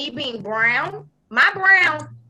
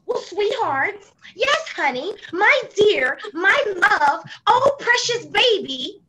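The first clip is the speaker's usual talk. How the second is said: higher, slow mock-sugary voice; mock sweet talk; 6 semitones higher than usual. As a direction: coo the pet names in mockery. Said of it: "you don't be like".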